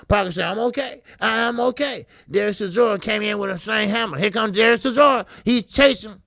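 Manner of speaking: loud, excited ringside call; shouting the knockdown at the mic; live play-by-play. Acted. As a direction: call the fight like a hyped announcer.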